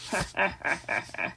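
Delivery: soft low snicker; a quiet scheming laugh; sly rat chuckle. A narrator's reading. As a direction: Templeton chuckling to himself. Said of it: "he chuckled".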